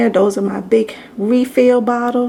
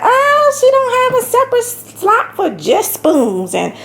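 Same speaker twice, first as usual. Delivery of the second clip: high, mocking nitpicker voice, louder; mocking critic; 12 semitones higher, 4 dB louder than usual. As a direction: whine it like a picky critic. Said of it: "some of y'all gonna be like".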